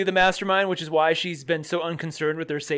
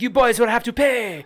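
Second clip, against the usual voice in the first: higher, louder mock French lady; sinister French proprietor; 6 semitones higher, 4 dB louder than usual. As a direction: demand payment like a haughty French shopkeeper. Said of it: "a sinister French woman".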